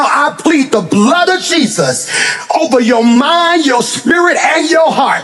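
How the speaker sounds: rising preacher's cry building to a shout; fiery preaching. Acted. Pastor pleading the blood over the congregation, climbing to a shout.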